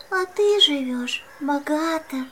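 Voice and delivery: ordinary delivery, nothing marked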